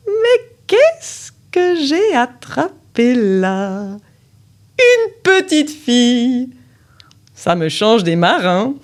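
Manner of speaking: high, slow, gloating witch voice; wicked sorceress. Acted. The sorceress gloats over the little girl she caught, high and slow. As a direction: savour the catch like a wicked enchantress. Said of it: "La femme a un méchant sourire".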